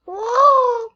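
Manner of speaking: high loud startled whoa; sudden loud gasp of surprise; surprised whoa. Real, not acted. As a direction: jump at what just appeared. Sudden reaction to the game.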